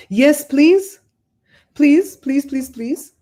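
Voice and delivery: high, loud burst of pleases; eager pleading. Real, not acted. Host asking viewer to send links, eagerly pleading.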